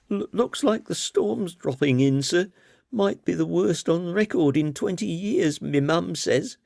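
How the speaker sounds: put-on high, nervous, fumbling boy's voice; high-pitched bumbling schoolboy, eager to please. A narrator's reading. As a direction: stammer it out like a flustered young lad trying to lighten the mood. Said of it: "bumbled the boy".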